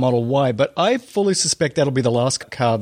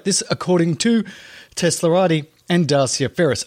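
ordinary delivery

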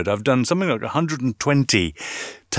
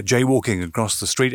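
ordinary talk, nothing marked

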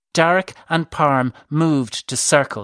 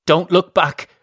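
louder, higher warning cry; urgent raised-voice command; 5 semitones higher, 5 dB louder than usual. A narrator's reading. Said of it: "cried Darek".

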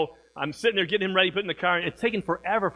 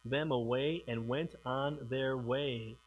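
scripture reading; 6 semitones lower, 8 dB quieter than usual. A narrator's reading. Pastor reading the passage to the congregation, solemn and hushed. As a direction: read the verse softly and gravely. Reads First Samuel scripture aloud.

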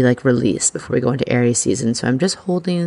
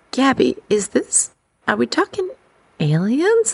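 incredulous questioning; 10 semitones higher than usual. Retold or imitated. Podcaster asking Gabby if it's aliens, incredulous. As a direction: lift it high and hesitate before the last word.

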